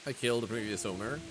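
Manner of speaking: usual speaking voice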